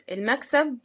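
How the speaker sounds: ordinary talk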